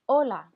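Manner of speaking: hola said loud and clear, silent h, O-la; teacher models hola; model word for repeating. Shown as a demonstration. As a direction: say hola firmly for the class to repeat.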